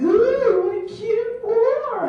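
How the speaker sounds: squealy high-pitched gushing, slow and laughing; mock girly swoon. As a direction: coo it like a starstruck girl gushing over a war. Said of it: "The women would be like".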